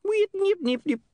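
very high repeated nips; tiny high-pitched imp chirps; fast-forward squeaks. Acted. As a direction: squeak like a skipping tape. Imp imitates fast forward noise.

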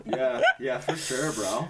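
loud laughing yeah; laughing agreement. Real, not acted.

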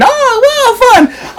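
sudden high, sing-song mock delight; mock cheerful. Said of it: "instead of being upside down".

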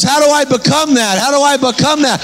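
high-pitched, urgent pleading, repeated; retold pleading. Healed man asks preacher how to become that, pleading. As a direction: imitate a man begging to know, pitch held high. Converted man begs to change.

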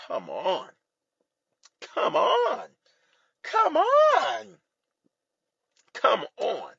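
pleading groan climbing to a very high wail, then easing; exasperated outburst. Retold or imitated. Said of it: "literally made me just say".

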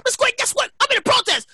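high, frantic retold confession; mock panic. Son tells mom he's at a protest, high mock panic. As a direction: blurt the news in hammed-up alarm.

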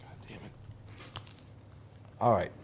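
muttered aside. Real, not acted. Muttering while turning off.

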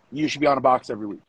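usual speaking voice

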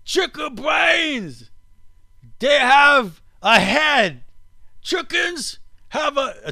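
put-on very high, slow, overblown Australian accent; mock Australian accent. Host imitates the oldest man for his cohost, hammed-up Australian accent. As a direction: stretch each word in a high fake Australian whoop. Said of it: "do I sound like an Australian?".

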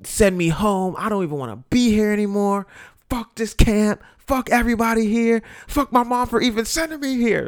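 put-on fed-up kid venting, pitch climbing; escalating mock rage; angry rant. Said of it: "I'm just like".

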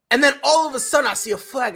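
normal talking voice

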